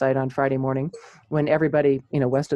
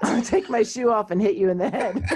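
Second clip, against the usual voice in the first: laughing threat; 7 semitones higher than usual. Real, not acted. Joking threat while laughing.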